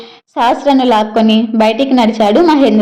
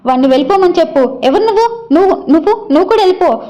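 shouting; 6 semitones higher, much faster than usual. A narrator's reading. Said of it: "అని అరిచింది వైశాలి".

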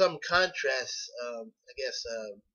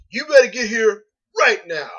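"You better get here right now" with "right now" punched much higher and louder; stressed warning to a child; 9 semitones higher, 17 dB louder than usual. Shown as a demonstration. Student showing adult word stress, "You better get here right now" with a loud stressed "right now". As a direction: hit "right now" hard like a parent calling a kid in. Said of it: "adults sometimes have stress on certain words".

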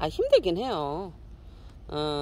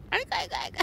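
laughing coo; 6 dB quieter, much faster than usual. Real, not acted. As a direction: laugh adoringly under the breath. Breathless adoring. (웃음 섞인 높은 속삭임 감탄)

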